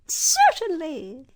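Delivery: grand tiny queen; squeaky mouse queen. A narrator's reading. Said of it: "answered the Queen".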